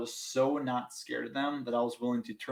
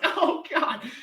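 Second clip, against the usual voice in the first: high laughing groan, louder; pained laugh; 5 dB louder than usual. Retold or imitated. Retelling the dog bite, a high laughing oh God. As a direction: laugh through the pain of the bite.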